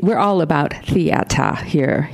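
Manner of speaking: slow Boston theata, r dropped to "ah"; attempted Boston accent on theata; Boston pronunciation. Shown as a demonstration. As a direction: say theata with an open Boston "ah" ending. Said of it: "I did it backwards".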